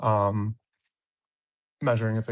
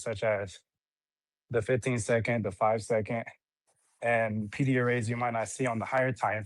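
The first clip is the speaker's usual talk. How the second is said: out of breath. Real, not acted. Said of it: "Sorry if I'm out of breath".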